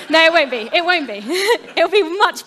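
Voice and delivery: laughing. Real, not acted. Preacher laughs at own joke.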